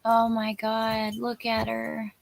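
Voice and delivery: normal talking voice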